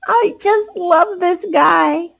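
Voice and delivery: put-on high, slow, dreamy child voice; adoring kid. A narrator's reading. Child cooing over the giraffe, high and slow. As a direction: coo over him like a smitten kid.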